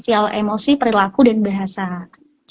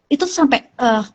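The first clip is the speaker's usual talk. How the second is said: normal talking voice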